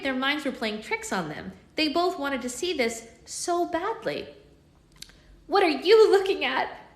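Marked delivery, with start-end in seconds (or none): 5.5-6.9 higher, louder, slow tiny fairy voice; fairy snapping at staring twins, tiny and high; tiny high-pitched voice. Acted. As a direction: squeak it like a cross little fairy. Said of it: "in a tiny, high-pitched voice".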